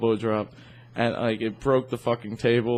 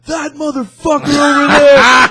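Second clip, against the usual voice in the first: put-on loud, high, slow drunken holler; hammed-up accusing yell; drunk guy yelling; 13 dB louder, slower than usual. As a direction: bellow it drawn out, imitating an angry drunk. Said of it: "yelled at him".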